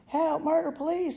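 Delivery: high shrill screams for help; mother screaming for help, very high. Acted. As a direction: scream in hysterical alarm. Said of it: "screamed Missus Gloop".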